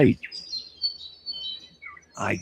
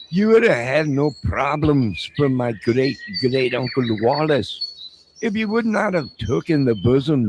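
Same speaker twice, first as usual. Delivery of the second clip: mock Scottish rant; 4 semitones higher than usual. Streamer ranting at a chatter in a mock Scottish brogue.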